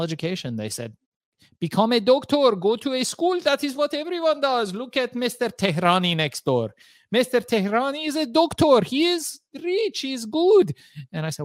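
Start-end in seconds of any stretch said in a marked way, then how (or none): 1.6-10.7 high-pitched mock Iranian-accented parent; parents' Iranian accent. Parents urging their son to become a doctor, Iranian accent. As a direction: imitate an Iranian parent lecturing, pitched well up. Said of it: "when I went to my folks".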